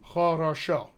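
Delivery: "хорошо" as a careful "hah-rah-SHOW"; deliberate, unreduced "хорошо"; full vowels. Shown as a demonstration. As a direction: sound out all three vowels of "хорошо". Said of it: "the word for good".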